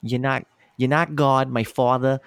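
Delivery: demonstrating a Long Island accent; mock-defiant Long Island accent. Shown as a demonstration. Host shows how the customer talked, Long Island accent. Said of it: "it's that Long Island accent".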